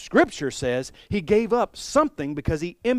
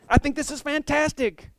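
excited claim; 11 semitones higher than usual. Retold or imitated. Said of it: "I was like".

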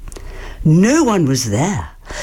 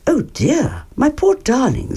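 higher soothing Mother Bear; Mother Bear comforts her baby, higher and soothing; comforting mother; 9 semitones higher than usual. Acted. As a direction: console the little one. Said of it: "said Mother Bear".

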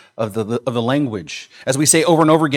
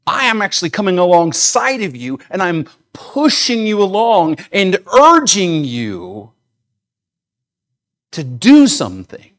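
urging along; 5 semitones higher, 5 dB louder than usual. Retold or imitated. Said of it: "The force of the Greek word actually means".

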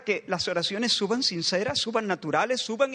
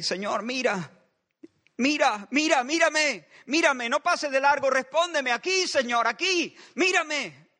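higher and louder, begging to be seen; desperate pleading prayer; 7 semitones higher, 3 dB louder than usual. Acted. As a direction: shout for help like a castaway signalling a ship. David's cry like a castaway. (súplica desesperada, más aguda y fuerte)

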